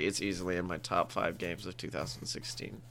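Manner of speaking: put-on low mopey kid voice; imitating Bud Bud. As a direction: drone it like a deadpan teen. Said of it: "He's like".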